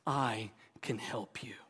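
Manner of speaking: soft unvoiced whisper; tender whisper. Jesus gently whispering to a struggling believer. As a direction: whisper it as a promise.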